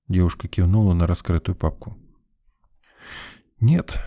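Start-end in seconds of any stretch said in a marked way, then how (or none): 3.6-4.0 a lone higher word, mildly let down; a touch of regret; disappointed sigh. A narrator's reading. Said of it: "вздохнул я огорчённо".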